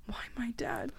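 grieving whisper; whispered plea. Acted. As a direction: barely voice the question. Victim asks killer, whispering.

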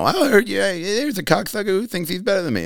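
high raspy Leno voice; imitating Jay Leno's gruff put-down; Jay Leno impression. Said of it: "you know".

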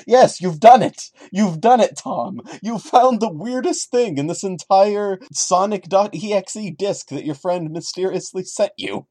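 high, loud, exaggerated congratulations; mock game-show cheer. Mocking the narrator Tom with a loud, high game-show cheer. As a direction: cheer him on like a cheesy game host.

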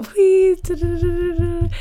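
high-pitched "da-da-da" drum roll; vocal drum roll. Pretend. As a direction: sing out a suspense-building drum roll. Mouths a drum roll before news.

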